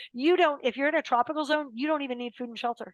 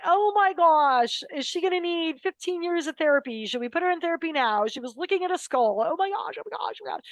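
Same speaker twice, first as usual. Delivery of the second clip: mock panic; 6 semitones higher than usual. Husband fretting over his daughter at night, mock panicked. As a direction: play a frantic parent winding himself up. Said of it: "were crying that night going".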